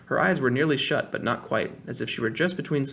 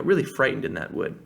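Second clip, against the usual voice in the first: plain reading voice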